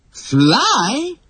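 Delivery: high, rising one-word cry; amazed children's gasp of a question; astonished. A narrator's reading. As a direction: exclaim it in disbelief. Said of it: "chorused the children in astonishment".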